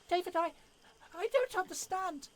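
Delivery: a startled lady calling out in disbelief; shocked woman. Acted. Fanny recognising her wounded husband, high and shocked.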